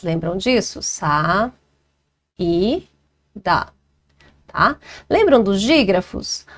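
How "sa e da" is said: "Sa e da" em três sílabas com pausas, tom grave; sílabas separadas. Shown as a demonstration. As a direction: separe "Sa", "e", "da", uma sílaba por vez. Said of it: "encontro de duas vogais em sílabas separadas". ("Sa e da" silabado devagar, com pausa entre cada parte)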